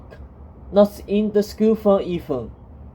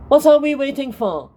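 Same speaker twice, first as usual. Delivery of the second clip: sharp, raised snap; snapping; 6 semitones higher, 3 dB louder than usual. A narrator's reading. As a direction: snap at them impatiently. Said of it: "Agatha snapped at them".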